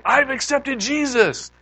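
high, loud, drawn-out imagined announcement; big imitated declaration of faith; joyous proclamation. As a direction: proclaim it slowly with hammed-up joy. Imagining a joyful family announcement.

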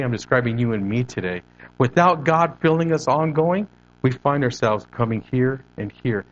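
usual speaking voice

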